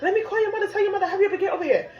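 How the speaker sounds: high, fast, laughing urgency; urgent alarm. Retold or imitated. Grandmother hearing the water broke, high and rushed in alarm. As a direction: rush the orders out in alarm. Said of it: "She like".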